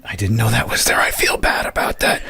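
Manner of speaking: whispered guilty confession; a real whispered apology; sheepish whisper. Real, not acted. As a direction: admit it under your breath, embarrassed. Guiltily admits missing the channel.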